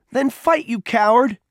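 very high, loud, furious yell; madman's scream. A narrator's reading. Boy kicking another goads him to fight, screaming madly. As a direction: scream it in a wild rage. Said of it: "Peter screamed like a madman".

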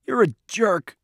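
high, tearful insult; sobbing. A narrator's reading. Crying boy lashes out at the one who hurt him, sobbing. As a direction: throw the insult through sobs. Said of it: "Peter sobbed".

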